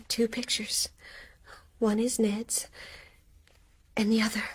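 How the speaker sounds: breathless whisper of dawning recognition; barely voiced, staring at the picture; hushed shock. Mary whispers in shock at the photo.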